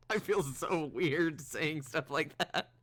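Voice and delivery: slow, loud, giggling; embarrassed laugh. Real, not acted. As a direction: laugh it off, flustered. Embarrassed after sultry lines.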